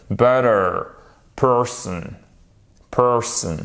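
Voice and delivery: Butter and Person with matching American r; isolated models, full r in Butter and Person; same ER sound. Shown as a demonstration. Teacher drilling the phonetic sign, clearly sounded ER. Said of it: "toujours la même prononciation".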